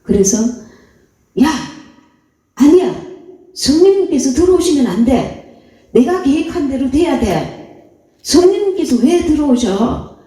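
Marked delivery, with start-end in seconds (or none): 1.4-10.2 mock stubborn believer. Preacher acts a self-willed believer.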